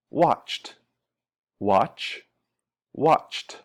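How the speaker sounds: "watched" as one syllable ending in crisp "t"; T ending demonstrated. Shown as a demonstration. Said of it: "E D is pronounced t".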